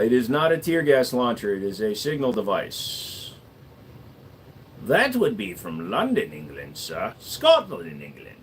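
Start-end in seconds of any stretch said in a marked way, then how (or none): 4.8-7.1 put-on posh London accent, fading low. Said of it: "my London accent". 7.2-8.4 put-on quick Scottish accent; a snatch of Scottish accent. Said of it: "I don't do the Scottish accent anymore".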